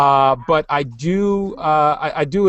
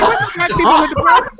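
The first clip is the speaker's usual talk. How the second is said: incredulous fan; 5 dB louder than usual. Retold or imitated. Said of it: "And like".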